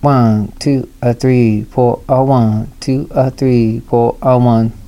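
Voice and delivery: slow spoken count; slow beat count; counted in four. Shown as a demonstration. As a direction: count it out deliberately. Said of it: "and then in four, you could write".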